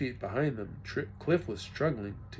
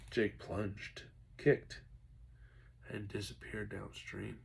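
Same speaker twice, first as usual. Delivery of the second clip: usual speaking voice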